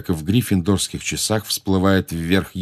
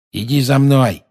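louder caretaker's command, pitched up; curt order; 6 semitones higher, 5 dB louder than usual. A narrator's reading. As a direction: order the boy along as the caretaker. Caretaker orders boy to follow. (приказ смотрителя, громче)

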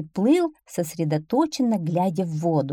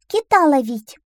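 high, loud duckling voice; bold little duckling; 9 semitones higher, 6 dB louder than usual. Acted. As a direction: answer cockily as a little duckling. Duckling boasts of whale hunt. (бойкий утёнок, высоко и громко)

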